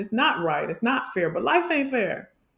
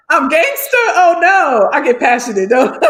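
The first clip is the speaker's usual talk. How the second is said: loud laughing protest, voice raised; laughing loudly; 5 semitones higher, 9 dB louder than usual. Real, not acted. Laughing off being called gangster.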